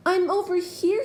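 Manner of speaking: high, loud yell. A narrator's reading. Jubal yelling to the boat, high and loud. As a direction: yell through the rain. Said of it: "he yelled".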